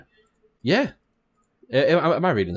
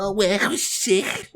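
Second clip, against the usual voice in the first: higher, slow put-on Scouse accent; mock Scouse accent; 10 semitones higher, slower than usual. Friend answering in the Scouse bit, slow hammed-up Scouse. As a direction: drag it out like a Scouse lad. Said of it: "And you're like".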